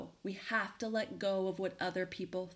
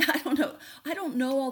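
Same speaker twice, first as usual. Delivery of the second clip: laughing through the words, higher and louder; 5 semitones higher, 7 dB louder than usual. Real, not acted. Coach laughing at her own story to listeners, real laugh.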